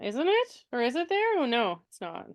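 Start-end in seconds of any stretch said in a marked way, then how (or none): none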